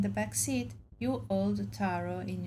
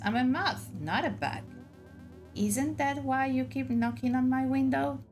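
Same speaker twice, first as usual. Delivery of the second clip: pixie; 6 semitones higher, 4 dB louder than usual. Acted. Moth creature corrects indignantly.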